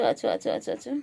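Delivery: usual speaking voice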